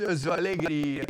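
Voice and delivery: slow, lightly mocked teenage reluctance; sulky teenager. Retelling his teenage mirror exercise, mildly sulky. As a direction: stretch it out like a mildly unwilling teen. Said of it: "Cara, eu falei assim".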